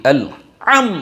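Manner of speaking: আল আম said with a harsh, overdone ha; overly harsh ha. Shown as a demonstration. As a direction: imitate the wrong harsh ha in আল আম. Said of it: "এভাবে উচ্চারণ করছে". (কড়া করে অতিরিক্ত হা উচ্চারণ)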